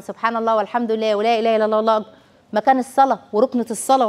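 ordinary delivery

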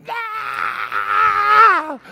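high, loud anguished cry of the flesh; mock tortured yell, loud and high; flesh screaming. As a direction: let the flesh howl in protest. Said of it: "your flesh is going".

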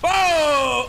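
long loud high "oh"; drawn-out startled holler; shocked shout. Real, not acted. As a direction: yell out in sudden shock. Sudden shock in game.

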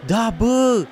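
high, loud yes of victory; triumphant cheer. Real, not acted. Streamer celebrating big drift points, triumphant shout. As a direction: cheer the score out.

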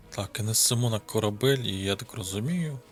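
ordinary delivery

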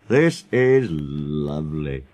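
slow, low, savouring murmur; deep, leisurely contentment; contented thought. Acted. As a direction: relish it in a low, drawn-out voice. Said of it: "he thought".

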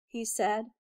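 plain reading voice

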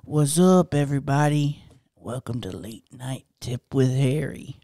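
low late-night host imitation; smooth low greeting, playing it cool; cool guy voice. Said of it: "This is your cool guy voice".

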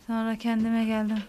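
ordinary talk

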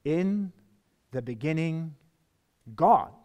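pause, then punch "God"; a plain, weighty declaration; emphatic GOD. Shown as a demonstration. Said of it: "says it so plainly".

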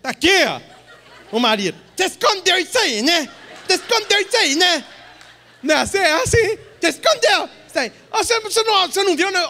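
loud, high mocking wife, laughing; wife teasing her husband, high and loud; exasperated wife. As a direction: scold him for missing what's in front of him. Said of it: "Aí a mulher chega".

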